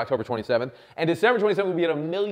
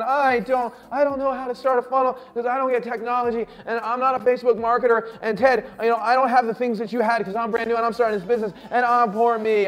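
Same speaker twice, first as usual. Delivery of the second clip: higher-pitched mock wail of self-pity; mock crying; 5 semitones higher, 3 dB louder than usual. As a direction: imitate whiny complainers in a high, strung-out voice. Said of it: "and they're crying".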